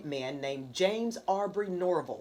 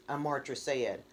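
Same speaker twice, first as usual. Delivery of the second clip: plain reading voice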